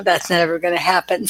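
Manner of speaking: ordinary delivery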